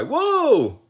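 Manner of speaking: very high, very loud stop cry; loud shout. A narrator's reading. Jack crying to the sawhorse before the wall, very loud and high. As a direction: yell with all he has. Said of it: "as loud as he could".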